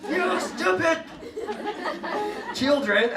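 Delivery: loud, high mock scolding; over-the-top scolding, amused; mock yelling at pupils. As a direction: yell it as a joke-angry teacher. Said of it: "I'm not talking about things like".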